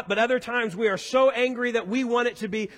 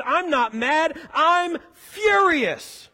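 higher pitch, drawn out on "furious"; acted fury; 5 semitones higher, 3 dB louder, much slower than usual. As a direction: proclaim the anger, hammed-up. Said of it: "went the opposite direction".

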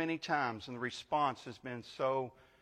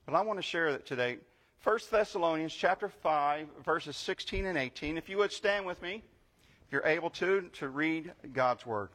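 brisk, higher, louder call to stand and read; rising pulpit voice; 3 semitones higher, 3 dB louder than usual. Acted. Pastor rousing the congregation to stand, energetic pulpit voice. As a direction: lift the congregation to its feet with energy.

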